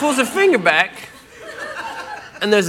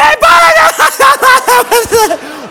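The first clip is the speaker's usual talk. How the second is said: put-on high, loud cackling laugh of the girlfriend; shrieking laughter; 6 dB louder than usual. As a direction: cackle at him without mercy.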